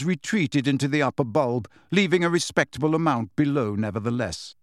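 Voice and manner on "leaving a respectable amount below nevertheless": ordinary delivery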